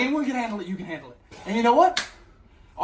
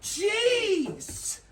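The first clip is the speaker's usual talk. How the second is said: shocked shout; 11 semitones higher than usual. Acted. Seeing a man naked, shocked shout. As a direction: yelp it in disbelief.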